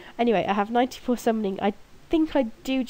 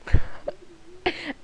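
a short breathless giggle, higher up; embarrassed laugh; 8 semitones higher, 4 dB louder than usual. Real, not acted. Laughing after letting her name slip. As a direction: laugh at your own slip.

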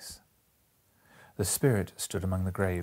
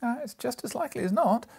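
much higher, lively father's voice; Bob's voice; 3 dB louder than usual. A narrator's reading. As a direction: read Bob as a cheerful father, pitched up. Bob speaks, pitched up.

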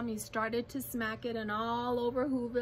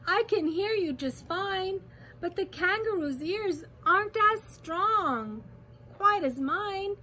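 high, urgent called reply; calling back; 7 semitones higher, 3 dB louder than usual. A narrator's reading. Elephant warning the mayor the kangaroo hears nothing, calling back. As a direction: call back insistently. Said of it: "Horton called back".

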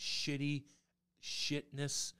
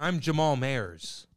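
loud, slow impersonation of a hockey player; Jamal Maris; 2 semitones higher, 8 dB louder, slower than usual. Acted. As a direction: announce yourself slowly and loudly in character. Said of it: "Is that your Jamal Maris impersonation".

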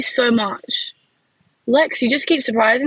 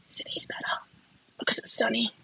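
playful conspiratorial whisper, much softer; whispered aside; 12 dB quieter than usual. Real, not acted. Streamer telling viewers a cheeky secret in a soft whisper. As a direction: let it slip as a secret.

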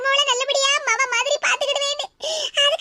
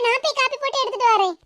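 ordinary talk, nothing marked

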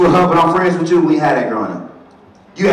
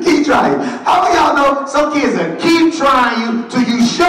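full-force preaching; 11 semitones higher, slower than usual. Acted. Preacher shouting at the congregation, full force.

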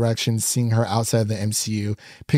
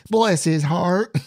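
drawn-out, higher-pitched mock Southern accent; bad Southern accent; 4 semitones higher than usual. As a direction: do a joking Southern drawl through laughter. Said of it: "I have the worst Southern accent".

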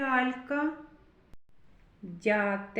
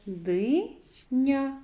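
"Диня" split Ди-ня with a pause; syllable by syllable; 2 semitones higher than usual. Shown as a demonstration. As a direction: read "Диня" out in two separate syllables. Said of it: "Давайте прочитаємо".

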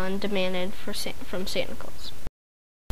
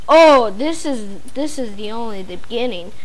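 scoffing. A narrator's reading. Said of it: "scoffed Bradley".

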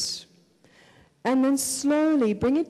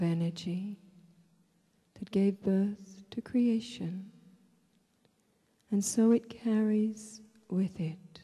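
guided meditation; 4 dB quieter than usual. Real, not acted. Leads a breathing meditation.

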